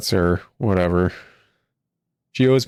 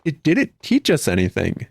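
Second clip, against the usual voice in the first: ordinary delivery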